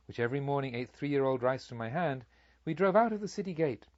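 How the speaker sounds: usual speaking voice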